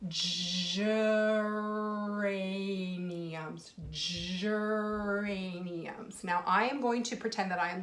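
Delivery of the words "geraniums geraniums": geraniums said extremely slowly, every sound drawn out; stretched out. Shown as a demonstration. Teacher stretches geraniums so kids hear each sound. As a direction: pull geraniums apart sound by sound. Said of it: "let's listen to it together".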